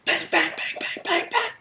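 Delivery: loud spat-out "bang" gunfire noises, rising sharply; imitated gunshots. Narrator acting out the dealer's shooting spree, loud gunshot noises. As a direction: fire off the bangs like a pistol.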